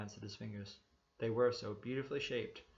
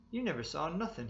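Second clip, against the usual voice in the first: higher, louder boast; proud; 6 semitones higher, 4 dB louder than usual. A narrator's reading. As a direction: crow about your own skill. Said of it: "he said proudly".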